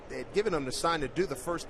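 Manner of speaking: ordinary delivery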